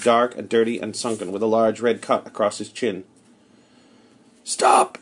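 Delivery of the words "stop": a sudden high, hoarse shout; hoarse cry. A narrator's reading. Armed stranger stops the narrator on the road, hoarse shout. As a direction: bark a hoarse command to halt. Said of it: "he cried hoarsely".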